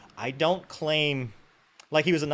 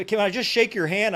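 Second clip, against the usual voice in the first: high, loud imitation of a hopeful admirer; eager fan; 8 semitones higher, 6 dB louder than usual. Evicted fan asking officer for handshake, high and eager. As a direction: beg the favour in a raised voice.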